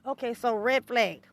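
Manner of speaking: normal talking voice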